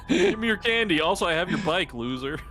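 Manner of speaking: put-on high, loud jeering kid voice; a teen bully demanding candy, high and loud; mocking teen bully. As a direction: sneer it out like a schoolyard bully. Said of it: "They sound like Bill Clinton".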